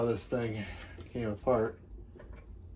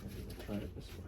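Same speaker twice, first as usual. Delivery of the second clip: muttering to himself; 10 dB quieter than usual. Real, not acted. Muttering to himself while working.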